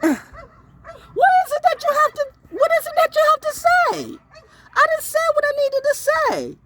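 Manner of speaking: a sulky ex begging to talk; mocking high voice.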